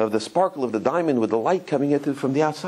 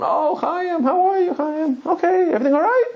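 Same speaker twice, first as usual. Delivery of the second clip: much higher, breezy and cheerful; sweet bride voice; 13 semitones higher, 3 dB louder than usual. Retold or imitated. Bride picking up after twenty minutes, high cheery mock voice. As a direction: play her as a bride blissfully unaware, sing-song high. Bride finally answers the phone.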